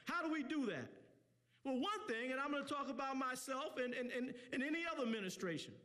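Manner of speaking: impassioned community preacher; fired-up speech from the pulpit; preaching. Real, not acted. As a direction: drive each point home to the crowd in rolling phrases.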